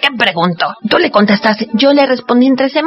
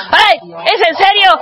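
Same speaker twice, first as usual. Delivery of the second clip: much higher, pleading for help; mock panic; 7 semitones higher than usual. Dinosaur begging the children for help in mock panic. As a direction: squeal it like a scared cartoon dinosaur.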